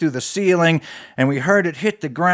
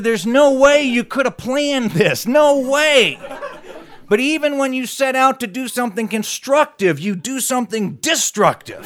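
a touch of disbelieving awe; marvelling sheriff; 8 semitones higher than usual. Acted. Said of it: "He said".

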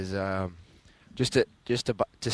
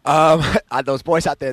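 laughing; 6 semitones higher, 11 dB louder than usual. Real, not acted. Player laughs recalling the play.

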